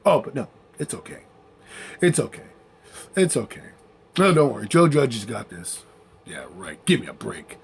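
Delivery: low, quiet sarcastic mock reassurance. Real, not acted. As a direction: mumble the fake comfort dripping with sarcasm. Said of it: "sarcastic mock reassurance".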